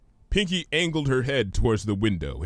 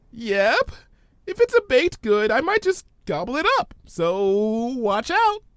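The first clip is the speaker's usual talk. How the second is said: much higher, bubbly pony; Pinky's voice; 13 semitones higher, 4 dB louder than usual. Acted. Pinky puzzled by Twilight's big word, high voice.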